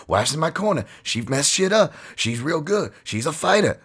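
high voice imitating the fighter's speech; high mock impression of a fighter; Corey Anderson impression. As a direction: mimic the fighter talking about his wife and truck. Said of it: "He's always like".

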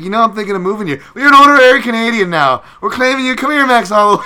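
loud, high mock Canadian welcome; mock Canadian claiming. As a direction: yell it like an overeager Canadian adopting a star. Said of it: "where Canada comes in first is just claiming anybody".

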